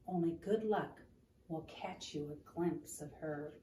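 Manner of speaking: gentle read-aloud storytelling; story narration. Acted. Reading picture book aloud.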